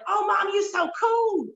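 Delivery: put-on high, laughing kid voice teasing mom; high laughing kid impression; mock kid voice. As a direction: play the kids, giggling and high. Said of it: "Were they like".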